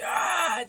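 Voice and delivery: short high mock yell of Wolverine charging; Wolverine battle cry. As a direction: let out a quick imitated charging scream. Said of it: "when he runs and he screams".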